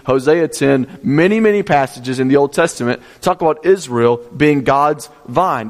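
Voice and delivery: ordinary delivery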